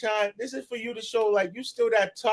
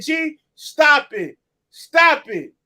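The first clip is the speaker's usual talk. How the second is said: firm call; 4 semitones higher, 7 dB louder, much slower than usual. Real, not acted. Calling to friend G.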